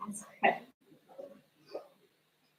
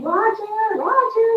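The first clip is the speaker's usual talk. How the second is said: long high calls, drawn out slowly; mom yelling; 11 semitones higher, 3 dB louder, slower than usual. Retold or imitated. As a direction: call out a lost son's name across the store. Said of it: "She's yelling".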